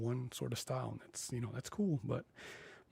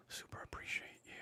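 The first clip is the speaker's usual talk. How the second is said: soft breathy whisper of thanks; 9 dB quieter, faster than usual. Real, not acted. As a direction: whisper it close to the mic.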